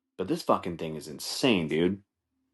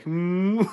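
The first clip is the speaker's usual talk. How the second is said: high, rising "hmm"; long quizzical hum; raised-eyebrow hum; 11 semitones higher than usual. Retold or imitated. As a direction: hum it like a suggestive question. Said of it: "that fucking raised eyebrow look".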